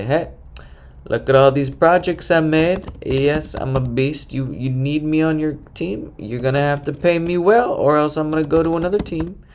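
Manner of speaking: brash, show-off job seeker; cocky bragging. Pretend. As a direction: boast like you know your worth.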